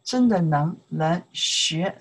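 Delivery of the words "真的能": ordinary delivery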